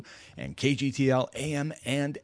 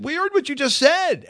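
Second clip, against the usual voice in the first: high, louder accusing voice as family; family in the car, accusing dad of slipping into an accent: much higher, louder mock accusation; 4 dB louder than usual.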